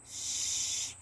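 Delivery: long, soft hushing sound; imitated shush. Owner imitating her bird telling dogs off, shushing. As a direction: shush the barking dogs without voice. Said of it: "She could tell the dogs".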